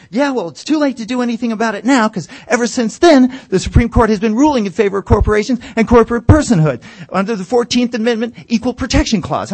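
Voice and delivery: mock argument shout. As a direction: hammer the legal point loudly. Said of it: "shouting match".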